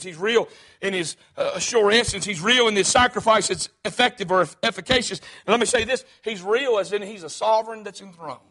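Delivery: ordinary talk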